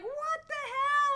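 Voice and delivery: very high, loud, slow outcry; mock outrage. Sophomores finding dead fish, outraged. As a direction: squeal it in disbelief.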